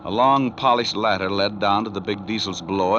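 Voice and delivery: ordinary talk, nothing marked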